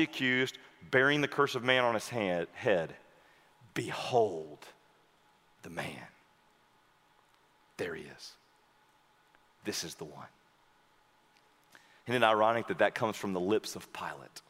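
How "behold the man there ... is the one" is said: much lower, much softer, almost a whisper; hushed, low awed murmur; reverent hush. Acted. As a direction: murmur it in awe. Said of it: "reverent climax".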